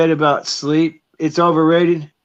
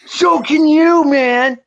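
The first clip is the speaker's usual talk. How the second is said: pitched way up and louder, taunting; high teasing comeback; 13 semitones higher, 6 dB louder, much slower than usual. Acted. Friend mocking his buddy's jab, high and louder. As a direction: fire it back in a high mocking voice.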